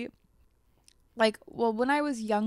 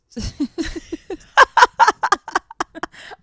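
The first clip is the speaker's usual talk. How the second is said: loud burst of laughter; 8 semitones higher, 14 dB louder than usual. Real, not acted. Laughing at her own story.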